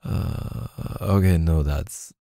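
ordinary talk

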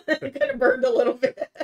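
high, loud, laughing through the words. Real, not acted. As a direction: laugh it out, squealing a little. Bubbles went up her nose.

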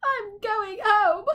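high, tearful wail building to a cry; a high, crying voice that breaks at the end; sobbing little demon. A narrator's reading. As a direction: sob it out, falling apart. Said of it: "he sobbed".

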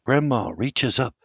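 plain reading voice

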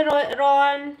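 ordinary talk

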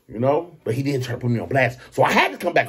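normal talking voice

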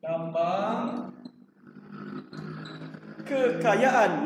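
slow fill-in-the-blank cue; drawn-out prompt. Shown as a demonstration. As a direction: stretch each word slowly, pausing between them. Teacher drawing out answer for class.

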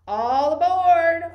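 loud slow cheerful call. Acted. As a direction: call everyone onto the raft. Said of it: "Oscar called".

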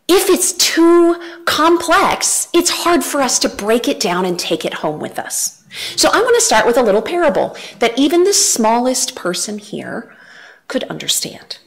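ordinary talk, nothing marked

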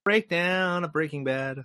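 high, drawn-out title call; playful slow announcement, pitched well up; show catchphrase. Pretend. Sign-off segment title on cue.

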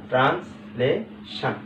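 "Translation" slow and soft, as trans, লে, শান; three spaced beats on "translation"; syllable by syllable. Shown as a demonstration. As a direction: sound out "translation" slowly in pieces. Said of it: "একসাথে আমরা বলতে পারছি trans".

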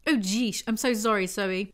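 ordinary talk, nothing marked

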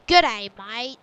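loud, low, exaggerated Australian twang; mock Australian greeting. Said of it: "I don't go".

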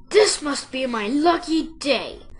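loud, gleeful fox shout. A narrator's reading. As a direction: crow loudly over a lucky catch. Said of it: "the fox shouted".

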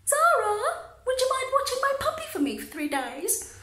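imitating a friend's high, casual request; breezy, no big deal. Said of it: "She tells me like it's no big deal. She said".

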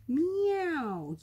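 long, high yowled meow; cat yowl. A narrator's reading. Cat alarmed by baby ghost, yowling. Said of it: "yowled Cora".

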